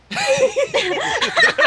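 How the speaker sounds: high burst of laughter; real laugh. Real, not acted. Group laughing at joke.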